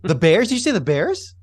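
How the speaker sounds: disbelieving. Real, not acted. Disbelief at Bears claim.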